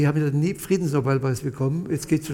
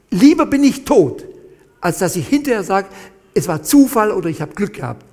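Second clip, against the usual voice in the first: desperate shout; 3 semitones higher, 7 dB louder than usual. Retold or imitated. Desperate prayer facing execution.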